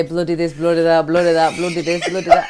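a light Yorkshire "bloody" with a laugh; Kaniz's Yorkshire accent. Retold or imitated. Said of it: "allowed me to say".

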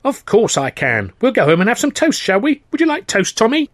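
put-on high, sing-song voice for a little boy; talking to a small child. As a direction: coax a small child with toast. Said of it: "addressed his reply to the boy".

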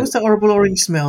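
high, loud, puzzled voice. Retold or imitated. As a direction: squeak it out, bewildered. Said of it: "she said that".

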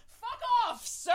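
high-pitched indignant blurt; prim, high-voiced telling-off; rude yet polite. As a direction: blurt it out high, then cap it with a courteous title. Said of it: "I just turned and went".

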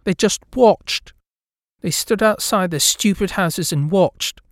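high, slow and resentful; bitter ghost girl. A narrator's reading. Ghost girl glaring at neighbours, bitter and slow. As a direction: spit it out with contempt for them.

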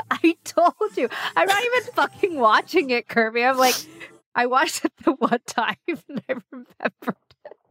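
high, laughing through it. Real, not acted. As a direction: crack up while scolding. Laughing at friend's tears.

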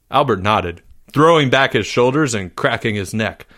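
ordinary delivery, nothing marked

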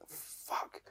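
voiceless, hushed swear to himself; acted whisper of dawning dread; dismayed whisper. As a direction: breathe the curse out under your breath. Waking lost, realising he's in trouble.

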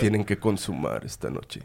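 imitating the priest turning stern and serious; changed priest tone. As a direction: drop into a solemn, heavier priest tone. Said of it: "Ahí cambió el tono de voz".

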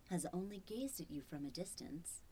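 breathy teasing whisper. Acted. Robot voice whispering to the owner. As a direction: hush it like a secret.

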